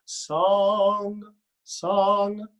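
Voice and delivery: song with the tongue up at the back for the ng; ringing ng in song. Shown as a demonstration. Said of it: "think of the word song".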